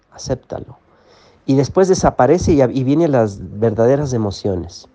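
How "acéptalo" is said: soft whisper of encouragement. Real, not acted. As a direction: breathe the word out gently. Gentle instruction to accept feelings. (susurro suave)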